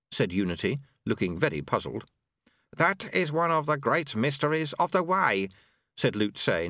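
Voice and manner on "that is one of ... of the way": higher-pitched sage monk voice; Lu-Tze, sagely. Acted. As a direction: pronounce it as a wise old monk. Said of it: "nodding sagely".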